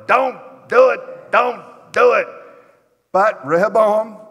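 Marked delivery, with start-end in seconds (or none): 0.0-2.3 put-on old men warning. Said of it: "you can see those old men saying".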